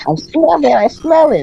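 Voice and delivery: put-on high, loud sing-song kid taunt; kid's taunt. A classmate taunting the boy who pooped, high and loud. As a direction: tease like a classmate smelling poop.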